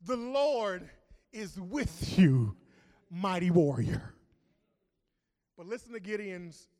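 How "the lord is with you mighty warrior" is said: loud, slow proclamation of the angel; booming angel. Acted. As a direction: announce it like a heavenly messenger. Angel's greeting read with force.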